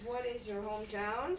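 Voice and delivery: ordinary talk, nothing marked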